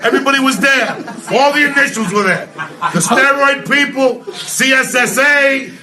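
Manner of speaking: loud, high-pitched comic tirade; outraged rant. Real, not acted. Comic railing about a rigged weigh-in, loud outrage. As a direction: holler it over the room in exasperation.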